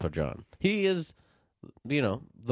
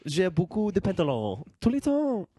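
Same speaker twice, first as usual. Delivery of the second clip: higher, sing-song put-on French; mock French; 5 semitones higher than usual. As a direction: show off textbook French proudly. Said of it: "You're very good, French".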